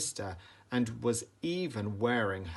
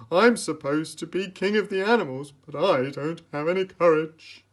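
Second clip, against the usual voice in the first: louder, higher whimpering lion; whiny cowardly lion; 6 semitones higher, 8 dB louder than usual.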